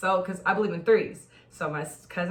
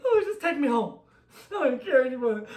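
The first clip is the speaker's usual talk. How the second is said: higher, laughing, defeated; upset; 6 semitones higher, 5 dB louder than usual. Retold or imitated. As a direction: give up with a laughing sigh. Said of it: "I was so upset".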